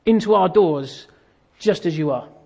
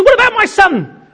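loud, very high comic retort; exasperated outburst; 11 semitones higher, 6 dB louder than usual. Retold or imitated. Customer snaps at checkout assistant, loudly exasperated. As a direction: fire it back at the top of your range. Quarrel climax, interrupted assistant.

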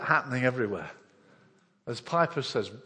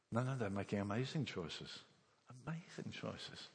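tender; 5 semitones lower, 13 dB quieter than usual. Real, not acted. Tender praise of sacrificing families.

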